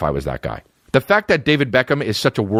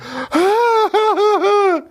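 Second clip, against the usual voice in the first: high mock moans of pleasure; high moaning; much slower than usual. Friend mocked while getting head, high mock moans. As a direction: moan up high, hammed-up. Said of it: "and he's just like".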